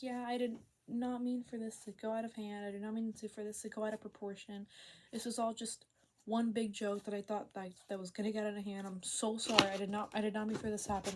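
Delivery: ordinary talk, nothing marked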